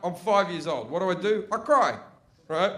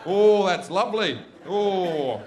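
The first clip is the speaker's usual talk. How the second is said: mock moan of pleasure; blissed-out dad; 4 dB louder, slower than usual. Dad tries the massager in front of his sons, blissfully. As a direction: imitate a dad savouring a massage, louder on the first words.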